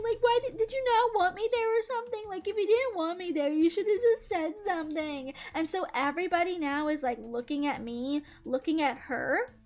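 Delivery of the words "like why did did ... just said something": high weepy whine, gradually settling; hammed-up sobbing plea, easing off; mock crying. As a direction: imitate a tearful relative's complaint. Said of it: "she just started crying".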